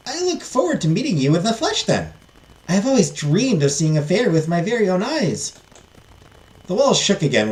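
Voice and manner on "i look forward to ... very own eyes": put-on higher voice of a hopeful young heiress; pitched-up, wistful eagerness; eager princess. Princess looking forward to meeting the fairy, eager high voice.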